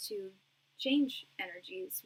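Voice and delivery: ordinary talk, nothing marked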